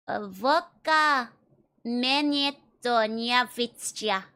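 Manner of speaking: Menetoniavitsja said very slowly, syllable by syllable; showing pronunciation. Shown as a demonstration. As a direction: drag out each sound of Menetoniavitsja for the chat. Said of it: "How do you pronounce it?".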